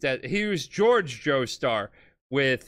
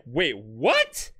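mock surprised Jojo; 7 semitones higher than usual. Jojo quoted, hearing about his mom.

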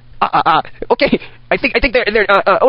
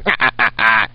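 low evil cackle; 9 semitones lower than usual. Acted. Evil Santa cackling after attack.

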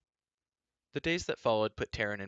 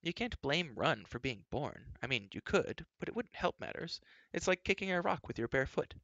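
higher voice with lively swoops; princess reasoning; 6 semitones higher than usual. A narrator's reading. Princess reasons with the sulking boy.

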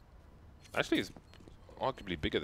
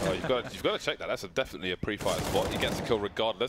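play-by-play commentary; 3 semitones higher, 3 dB louder than usual. Acted.

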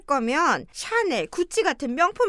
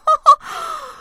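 1 scoffing laugh; 11 semitones higher, 6 dB louder than usual. Acted. Mother-in-law scoffs at request.

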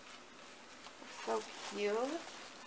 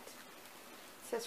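hushed whisper; 3 semitones higher, 11 dB quieter than usual. Real, not acted. Reading the artist's card, whispered. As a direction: breathe it softly.

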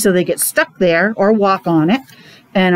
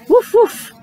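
high playful woof with a rising end; dog barking; 11 semitones higher than usual. A narrator's reading. Reading to a child, barking again as the dog. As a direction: yap it like a cheerful puppy. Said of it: "said the dog".

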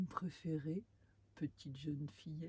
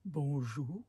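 timid giant; 5 semitones lower, 5 dB louder than usual. Acted. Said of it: "un petit peu intimidé".

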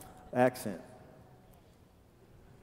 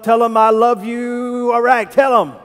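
loud, high preacher's exhortation; rallying shout; 5 semitones higher, 8 dB louder than usual. Real, not acted. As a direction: urge the whole room at full volume. Rallying congregation to say it.

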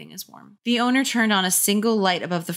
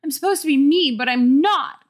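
higher and louder, bitterly; bitter, raised cry; bitter outburst; 8 semitones higher, 6 dB louder than usual. Acted. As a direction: spit it out in frustration. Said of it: "Carly Beth thought bitterly".

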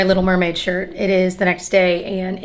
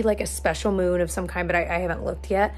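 usual speaking voice

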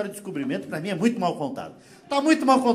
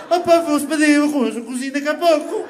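mock European Portuguese, pitched higher; Portuguese accent; 6 semitones higher, 4 dB louder than usual. Comedian imitating Portuguese hosts in a Portuguese accent. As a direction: put on a European Portuguese lilt. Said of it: "Qualquer coisa que eles falavam".

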